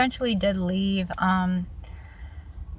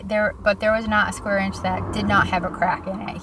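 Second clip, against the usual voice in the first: ordinary delivery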